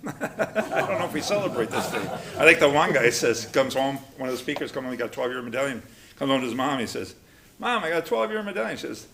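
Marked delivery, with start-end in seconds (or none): 0.1-2.1 talking through a laugh. Real, not acted. Joking about a celebration. 7.6-8.8 imitated high-pitched proud news; proud announcement. Imitates man proudly telling his mother.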